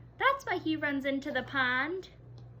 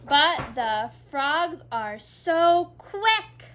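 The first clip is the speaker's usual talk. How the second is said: loud, slow and excited; story-time excitement; excited reading; 10 dB louder, much slower than usual. Shown as a demonstration. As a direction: read it with excitement. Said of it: "We say that with excitement".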